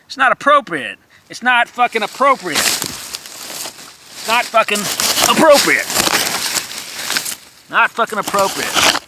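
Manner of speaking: ranting exasperation; mounting outrage. Real, not acted. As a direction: build the fury word by word. Mock outrage, escalating.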